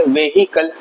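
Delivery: व्हीकल as ve-hi-kal, h sounded; three-syllable व्हीकल with an audible hi; common mispronunciation. Shown as a demonstration. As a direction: pronounce the h in व्हीकल. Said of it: "ये व्हीकल नहीं होता है".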